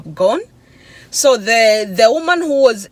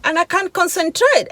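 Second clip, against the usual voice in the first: high, strained-up self-defence; retold protest; 9 semitones higher than usual. Driver defending herself to the yelling woman, high retold protest. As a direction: protest in a much higher pitch, re-enacting it. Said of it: "I'm like".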